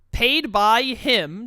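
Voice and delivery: ordinary delivery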